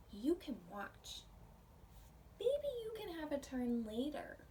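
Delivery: gentle read-aloud storytelling voice; picture book reading. Acted. Teacher reading a picture book to her pre-K class, storytelling voice. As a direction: read the story warmly to little kids.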